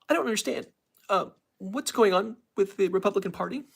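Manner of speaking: high, puzzled anchor voice; Rachel Maddow impression. Streamer closing with a Rachel Maddow impression, high and puzzled. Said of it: "That was my Rachel Maddow impression".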